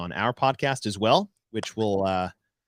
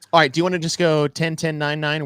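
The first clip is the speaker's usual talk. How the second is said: ordinary talk, nothing marked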